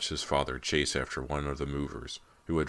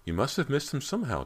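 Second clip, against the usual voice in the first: mother voice, higher; 11 semitones higher than usual. A narrator's reading. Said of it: "Missus McCloy said".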